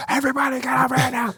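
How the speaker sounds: put-on a young leader losing it on the radio; panicked yell. Young SEAL leader on the radio, frantic high panicked yell. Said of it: "young seal leaders would start freaking out and yelling and screaming".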